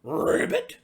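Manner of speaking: croaked questioning ribbit, loud; froggy ribbit. Acted. Little pig croaking at the frogs, loud questioning ribbit. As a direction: croak it like a confused frog.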